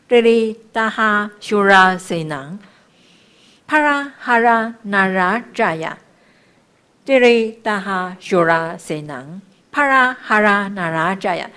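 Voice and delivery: loud, measured syllable-by-syllable chant; sustained chanting of sacred syllables; mantra recitation. Shown as a demonstration. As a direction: recite it firmly, phrase by phrase.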